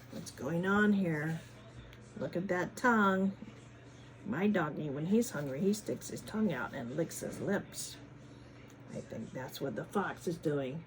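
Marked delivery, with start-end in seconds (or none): none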